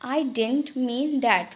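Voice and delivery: very slow, drawn-out demo; Hindi: बहुत धीरे खींचकर; very slow model phrase. Shown as a demonstration. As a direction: stretch it out for learners. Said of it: "मतलब".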